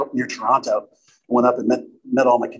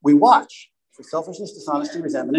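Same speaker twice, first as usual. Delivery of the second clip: normal talking voice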